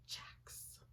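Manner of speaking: a hushed, secret reveal; whispered name. Real, not acted. Reader whispering a character's name to the camera.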